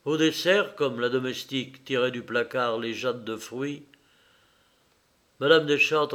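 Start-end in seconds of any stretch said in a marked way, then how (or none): none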